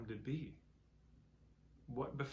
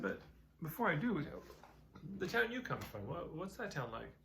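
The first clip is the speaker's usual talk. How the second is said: put-on curious old man; 6 semitones higher than usual.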